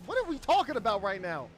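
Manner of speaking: incredulous. Real, not acted. Baffled by the sandwich debate.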